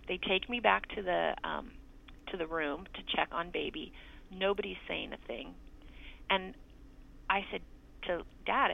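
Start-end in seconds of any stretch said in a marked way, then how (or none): none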